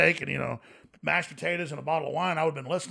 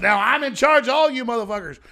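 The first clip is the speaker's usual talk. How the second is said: loud, gloating, a bit higher; mock villain boast; 6 semitones higher, 9 dB louder than usual. Retelling a tycoon's tweet, loud mock gloat. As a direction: ham up a gloating tyrant.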